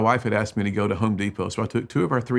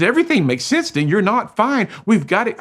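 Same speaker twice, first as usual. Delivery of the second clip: insistent wife; 11 semitones higher, 6 dB louder than usual. Retold or imitated.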